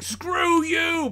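sharp high shout. Retold or imitated. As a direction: yell it at the studio. Writer yelling at studio.

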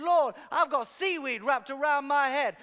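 loud, high exasperated cry; frustrated rant. Retold or imitated. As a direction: pour out the frustration at full volume. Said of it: "I'm just telling him everything".

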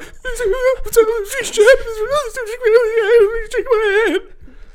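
very high sobbing laughter; hysterical laugh-cry. Retold or imitated. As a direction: laugh and cry at once, overwhelmed. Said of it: "'cause I was going".

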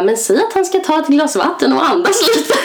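high, giggly quoted advice; laughing retelling. Retold or imitated. Passing on her friend's panic advice to listeners, laughing. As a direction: barely hold back laughter while quoting.

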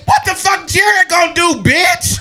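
high, loud scornful challenge; retold angry shout. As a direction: yell it back, daring and hammed-up. Retells angry comeback in quarrel.

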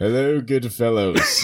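slow, laughing mock-posh greeting; lightly voiced Bigfoot, slow and amused.

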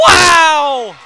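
stunned, all-out shout; shouted wow. Real, not acted. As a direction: let the amazement burst out. Reaction to walk-off homer.

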